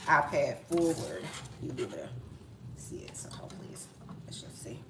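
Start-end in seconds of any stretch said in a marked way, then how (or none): 1.6-4.8 soft whisper to herself. Real, not acted. Checking the camera angle in a real whisper. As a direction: breathe it while fiddling with the iPad.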